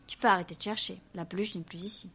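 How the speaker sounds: soft, low-key line to a brother; hushed, understated announcement; quietly matter-of-fact. A narrator's reading. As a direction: say it softly, almost under the breath. Girl talks to her brother.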